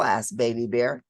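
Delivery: ordinary delivery, nothing marked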